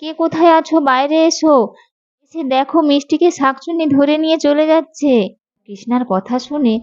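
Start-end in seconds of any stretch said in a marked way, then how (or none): 0.0-5.1 high-pitched, urgent calling out; alarmed shouting. Acted. Mother sees a witch taking her daughter, alarmed shouting. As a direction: challenge the kidnapper, then call everyone outside. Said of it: "তুমি এত চিৎকার করছো কেন?".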